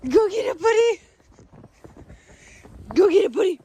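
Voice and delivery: loud, high fetch call; excited call to fetch; urging a dog. Real, not acted. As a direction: send the pup off, loud and high.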